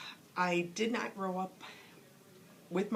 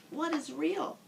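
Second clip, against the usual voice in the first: higher, wondering little rabbit voice; curious toy rabbit; 7 semitones higher than usual. Acted. The rabbit asking the old Skin Horse, high and wondering. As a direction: ask it like a small innocent toy. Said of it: "asked the rabbit".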